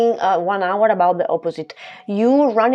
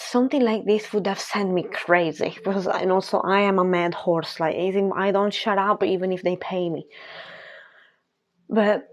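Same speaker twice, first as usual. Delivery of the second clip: ordinary talk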